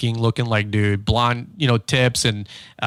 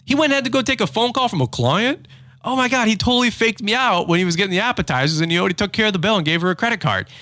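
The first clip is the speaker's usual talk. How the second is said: much higher, rising to a frantic pitch of mock outrage; exasperated disbelief; 9 semitones higher than usual. A diner outplayed by his friend, in high-pitched comic exasperation. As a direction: rant in high-pitched comic exasperation at being beaten to the bill. Inner rant after friend pays bill.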